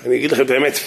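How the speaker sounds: ordinary talk, nothing marked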